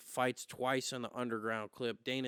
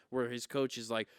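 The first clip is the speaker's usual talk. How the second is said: ordinary talk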